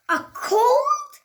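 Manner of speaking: very high loud a cold; surprised question. Acted. Gerald hearing the diagnosis, high surprised squeak. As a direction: squeak it in disbelief.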